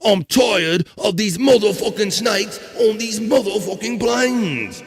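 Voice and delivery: hammed-up Australian accent on the snake line; high, playful Australian accent. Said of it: "in an Australian accent this time".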